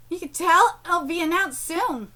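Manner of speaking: normal talking voice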